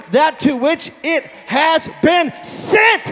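shouted verse. Shown as a demonstration. Preacher leading the congregation, shouting the verse. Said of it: "if you know that verse, shout it".

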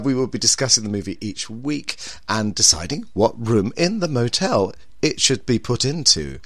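ordinary talk

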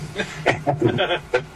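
amused laugh mid-story; real laugh. Real, not acted. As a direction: laugh at the memory.

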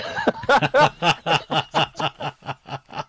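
helpless laughing fit; long burst of laughter. Real, not acted. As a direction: crack up at your own story. Cracking up at the quote.

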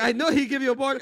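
loud, high mock scolding; angry mom. Mom knows dad gave pork, mock angry. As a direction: accuse like a fed-up mother.

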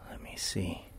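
soft unvoiced whisper, checking the shot. Real, not acted. Whispering to herself as she checks her sunset photo. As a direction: murmur it under your breath.

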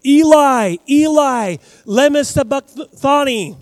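a high, loud, anguished scream of the words; anguished loud cry. Retold or imitated. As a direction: cry it out like a man in agony. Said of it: "He said this in a loud voice".

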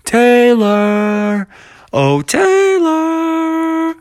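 high, long, stretched calling. Acted. Friends call out for lost Taylor, high and drawn out. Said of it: "They started calling out his name".

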